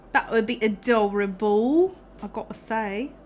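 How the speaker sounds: imitating a teacher's fake British accent; lightly hammed-up British accent; bad British accent. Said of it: "put on a really bad, bad, uh, British accent".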